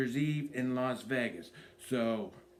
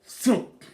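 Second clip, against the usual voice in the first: loud, high thump effect; thump sound; 9 semitones higher, 8 dB louder than usual. Retold or imitated. Comedian voicing the beer cup hitting a trash can, loud thump. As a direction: punch out the thump of the cup landing.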